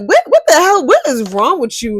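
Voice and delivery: high, loud shocked "what the hell"; loud, high incredulous outburst. Retold or imitated. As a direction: blurt it out in disbelief. Her shocked reply, retold.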